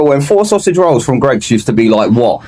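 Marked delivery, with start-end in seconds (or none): none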